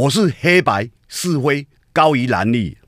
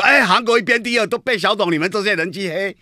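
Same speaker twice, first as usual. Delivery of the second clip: mock Han fan complaint; 8 semitones higher than usual. Host mocks Han fans blaming him, high whiny complaint. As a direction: imitate a Han supporter griping, pitched up. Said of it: "一定很多人讲说".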